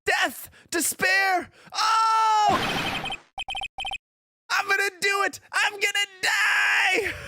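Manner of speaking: high, despairing scream; screaming client. Acted. Client screaming in despair across the room, shrieked high. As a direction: wail it at full dramatic pitch. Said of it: "Is that your client screaming over there?".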